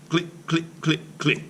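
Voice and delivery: ordinary delivery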